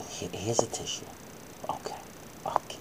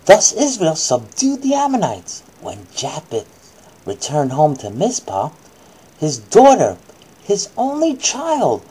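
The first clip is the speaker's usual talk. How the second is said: put-on reading to a child; 2 semitones lower, 4 dB louder than usual. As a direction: clear storybook reading voice. Reads Bible story to child.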